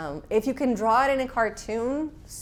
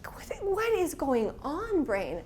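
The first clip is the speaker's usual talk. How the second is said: high, slow, baffled question; bewildered self-scolding; exasperated at herself; 7 semitones higher, much slower than usual. Retold or imitated. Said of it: "scolding her own brain".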